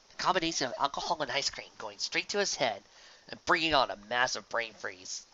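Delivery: ordinary delivery, nothing marked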